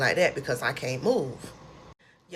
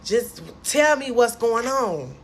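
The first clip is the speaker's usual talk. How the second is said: louder, slow, distressed plea; upset pleading; 6 semitones higher, 4 dB louder than usual. Retold or imitated. Patient in hospital bed begs nurse for answers, distressed and louder. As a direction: demand answers through tears. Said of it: "I just start crying even more".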